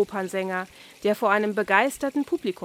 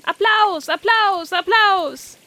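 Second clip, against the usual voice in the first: much higher and louder chant; the carp clapping and cheering for Oskar; excited cheering; 10 semitones higher, 7 dB louder, slower than usual. Acted. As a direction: call for applause. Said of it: "aufgeregt rufen und mit den Flossen klatschen".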